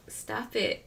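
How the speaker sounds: feeble, soft scolding; a half-hearted order, barely voiced; Joe Biden. Imitating a president's weak demand to Netanyahu, very softly. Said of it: "with Biden, he was like".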